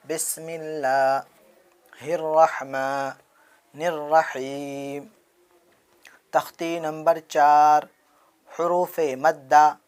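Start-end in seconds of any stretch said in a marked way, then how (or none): 0.1-5.0 slow, drawn-out recitation with pauses. Shown as a demonstration. Teacher reciting slowly for children to repeat. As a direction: recite it slowly for students to copy. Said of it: "چلیے پڑھیے میرے ساتھ".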